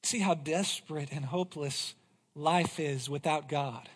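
ordinary talk, nothing marked

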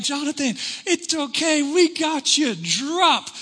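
high, bright mock girls' voices; hammed-up cheerleaders; young girls coaxing him down; cheerleaders reassuring. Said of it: "They're like".

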